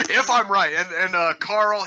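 laughing through the words. Real, not acted. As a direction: giggle as you say it. Laughing after Carl topic.

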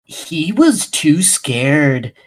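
hammed-up, deliberate storyteller; stupid narrator voice. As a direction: stretch it out like a corny moral-tale narrator. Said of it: "The, the stupid voice".

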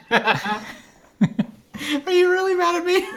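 laughing through the words; high, slow, cracking up. Real, not acted. Joking mock accusation while laughing.

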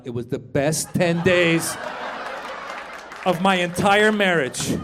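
ordinary talk

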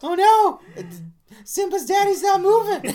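very high, loud wail, laughing through; a child horrified at the scene; kid panicking. Retold or imitated. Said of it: "And it's like".

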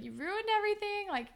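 high-pitched mock tearful complaint; imitated crying. Anya blaming Holly J in a retelling, imitated crying. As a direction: wail it lightly, without real tears. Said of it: "like, crying".

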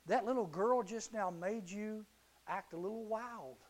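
ordinary talk

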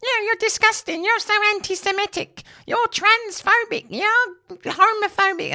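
mocking Twitter ranters. Podcaster reading Twitter rants in a squeaky parody voice. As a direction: squeal out the accusations like a cartoon. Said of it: "that's how I read your Twitter rants".

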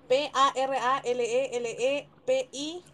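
slow letter-by-letter spelling; deletreando. Shown as a demonstration. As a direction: spell carefully, each letter apart. Su turno de deletrear. (deletreando despacio)

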